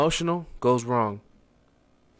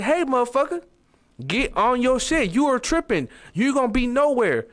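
raised, higher pitch, calling him out hard; blunt tough talk; 9 semitones higher than usual. Retold or imitated. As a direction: snap a friend out of slacking, no sugarcoating. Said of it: "Instead of telling them, like".

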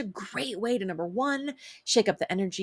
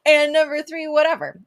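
1 laughing through it; 8 semitones higher, 6 dB louder than usual. Real, not acted. Laughing through her own list.

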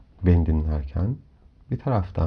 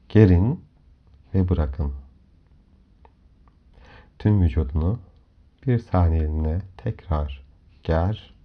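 usual speaking voice

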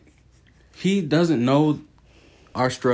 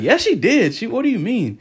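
high-pitched, indignant mock retort; insistent retort; 10 semitones higher than usual. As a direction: argue back as the insistent wife. Acted wife insisting in retold argument.

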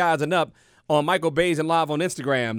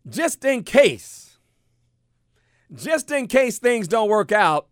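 drawn out; 3 semitones higher, much slower than usual. Real, not acted. Drawn out key phrase.

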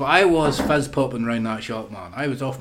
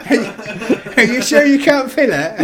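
much higher and louder, laughing through it; hammed-up teasing squeal; 11 semitones higher, 7 dB louder than usual. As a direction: keep up the mock squeal. Mocking innuendo, repeated.

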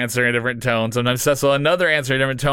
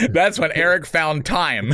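laughing; 7 semitones higher, slower than usual. Real, not acted. Still laughing after the joke.

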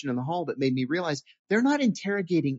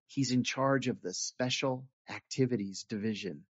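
exasperated; 2 semitones lower, 7 dB quieter than usual. Retold or imitated. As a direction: explain it softly, fed up with the question. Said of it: "kind of exasperated".

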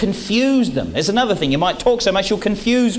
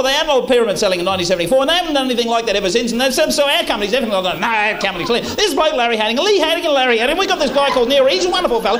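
rambling salesman; 3 semitones higher, faster than usual. Pretend. Salesman gabbling to a prospect, fast and nervous. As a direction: rattle through the denials without a breath. Overeager salesman babbling defensively.

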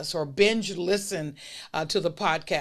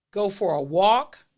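ordinary delivery

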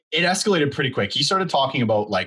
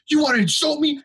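very high-pitched indignant challenge; imitating outraged customer. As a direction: mimic the affronted customer, pitched way up. Offended customer closing in, acted out.

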